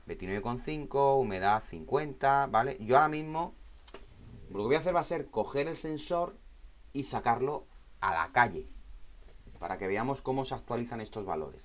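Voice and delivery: ordinary talk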